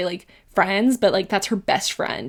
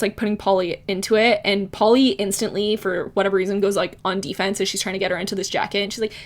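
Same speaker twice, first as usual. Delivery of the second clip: usual speaking voice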